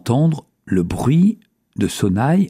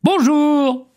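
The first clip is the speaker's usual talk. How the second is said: very high, joyful hello; cri joyeux. A narrator's reading. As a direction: call out happily across the valley. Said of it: "le jeune berger cria joyeusement". (crier joyeusement, très haut)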